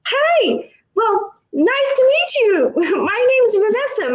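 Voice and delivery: high, bubbly mock neighbor voice; imitating Vanessa. Vanessa greeting narrator while hobbling over, high chirpy. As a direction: play her chirpy and a bit frazzled. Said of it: "and she's like".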